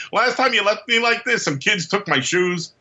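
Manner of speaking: high aggrieved little voice; webbed-up guy. Retold or imitated. Stuck on a web, griping to Spidey, high and aggrieved. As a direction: complain with a high, put-upon whine.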